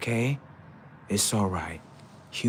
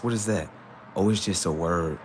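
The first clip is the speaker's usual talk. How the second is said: ordinary talk, nothing marked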